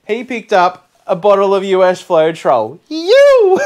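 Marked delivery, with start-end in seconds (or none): 2.9-3.6 excited whoop. Real, not acted. Thrilled by the gift.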